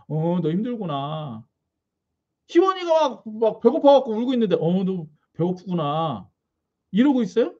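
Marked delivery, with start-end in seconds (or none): 0.1-1.4 slow, low, detached reply; just watch and shrug it off; a mild uncaring parent, slow and low; flat indifferent onlooker. Retold or imitated. Indifferent God imitated. 4.6-6.3 slow, low, detached reply; just watch and shrug it off; a mild uncaring parent, slow and low; flat indifferent onlooker. Retold or imitated.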